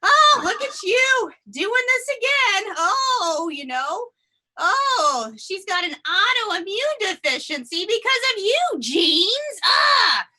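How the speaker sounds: high, loud scolding groan; exasperated cells. Pretend. Fed-up cells scolding the genes, high and loud. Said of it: "they're just like".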